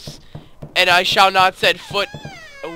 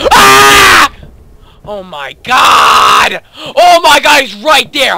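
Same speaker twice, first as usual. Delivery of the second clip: loud, high terrified shouting; terrified screaming; 7 semitones higher, 9 dB louder, much slower than usual. Real, not acted. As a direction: scream in real terror. Monster appears in horror game.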